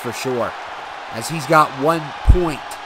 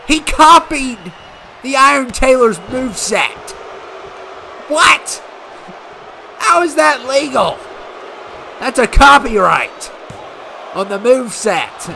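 outraged disbelief; 7 semitones higher than usual. Real, not acted. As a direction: sound stunned and indignant at once. Outraged at copied moveset.

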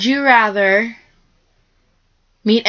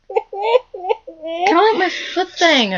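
laugh-crying; 9 semitones higher, much slower than usual. Real, not acted. Laughing while pestered.

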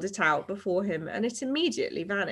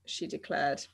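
plain reading voice

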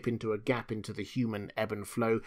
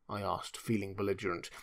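usual speaking voice

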